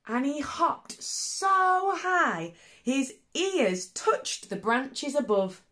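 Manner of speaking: high, slow, amazed; wonder. A narrator's reading. Reader marvelling to children at the hop, high and slow.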